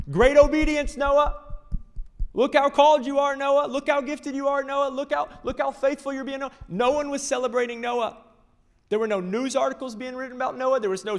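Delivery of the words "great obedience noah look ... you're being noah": loud, high, hammed-up praise; mock cheering crowd. Imagined neighbors loudly cheering Noah on. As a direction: cheer him on like a fan.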